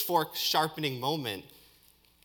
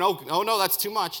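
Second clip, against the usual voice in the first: higher, louder feigned objection; mock dismay; 6 semitones higher, 4 dB louder than usual. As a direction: protest in mock alarm. Acting out a mock reaction.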